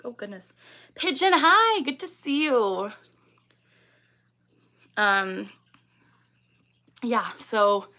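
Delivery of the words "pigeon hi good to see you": high, delighted hello; excited greeting. Real, not acted. Streamer spots a viewer in chat, excited. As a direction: greet them loudly, stretching the last words.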